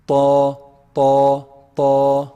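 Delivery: loud, held "Ta" with a heavy Arabic t; heavy letter ta. Shown as a demonstration. Teacher demonstrating for students, "Ta" loud and heavy. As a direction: hit the roots of the upper incisors on a heavy "Ta". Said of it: "It's a heavy letter".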